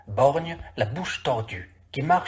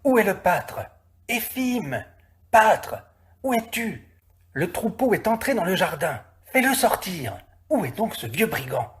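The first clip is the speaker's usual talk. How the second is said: shouting from the bank; 6 semitones higher than usual. A narrator's reading. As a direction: call for the missing shepherd. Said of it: "on crie".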